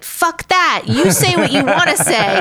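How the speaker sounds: high, emphatic mock-indignant cheer; defiant retort. Women listeners cheering on the swearing host, defiant. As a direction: push it out high like a fired-up supporter.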